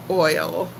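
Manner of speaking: "oil" said carefully, low; "oil" without the old Texas accent; learned pronunciation. Shown as a demonstration. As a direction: say "oil" in the learned, non-Texan way. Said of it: "I've learned how to say oil".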